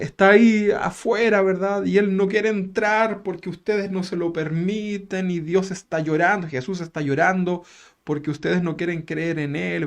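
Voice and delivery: hammed-up melancholic sermon; histrionic melancholy. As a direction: mourn for Jesus at the door theatrically. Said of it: "una reflexión melancólica, histriónica".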